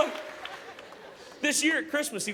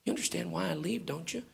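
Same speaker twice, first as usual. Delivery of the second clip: tender, hushed; 8 dB quieter than usual. Retold or imitated. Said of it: "I said".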